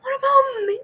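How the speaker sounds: very high, loud half-sobbed shout; hammed-up crying outburst; mock crying wail. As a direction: wail it like you're about to burst into tears. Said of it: "I'm going to start to cry".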